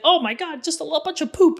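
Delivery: high, goofy mock shock; playful put-on voice to get a giggle; silly. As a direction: make light of it in a squeaky-high silly voice. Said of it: "You can be a little silly with it".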